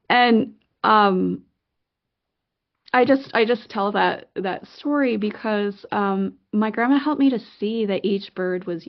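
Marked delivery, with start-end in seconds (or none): none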